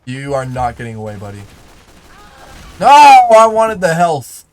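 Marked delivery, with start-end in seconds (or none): 2.8-4.4 frustrated cry. Real, not acted. Losing health pickup in game.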